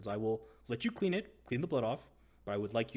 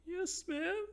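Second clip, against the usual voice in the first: very high, small submissive squeak; whimpering. Acted. Scolded prisoner obeying the woman, meek high whimper. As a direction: give in with a timid falsetto whimper. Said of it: "he's just whimpering".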